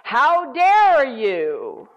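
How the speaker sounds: loud, very drawn-out demonic challenge; menacing voice. Retold or imitated. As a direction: boom it out slowly, imitating an outraged demon. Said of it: "And it said it again".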